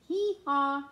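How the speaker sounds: loud braying hee-haw like a donkey. Acted. The donkey in the song braying, loud. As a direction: bray it out, loud and high.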